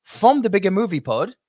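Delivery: announcer intro. Acted. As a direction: hype up the guest like a show host. Introducing a guest live.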